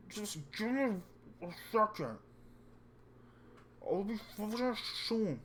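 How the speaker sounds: talking through a mouthful; words round a bite of food; mouth full. Acted. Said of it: "While talking with his mouth full".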